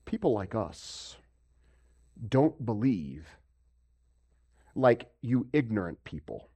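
mock superior hush; a hint of a snob dismissing ignorant people; condescending sneer. Preacher voices condescending scoffers.

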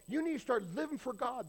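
mock scold.

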